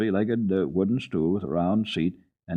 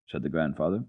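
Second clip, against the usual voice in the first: usual speaking voice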